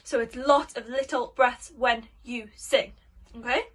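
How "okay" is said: usual speaking voice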